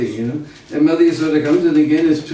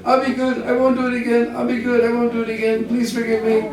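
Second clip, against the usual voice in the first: put-on high, pleading little-boy voice; pleading child; 7 semitones higher than usual. Lala begging Mother Yasoda to forgive him, high pleading child voice. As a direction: beg for mercy like a scared little boy. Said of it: "And he's saying".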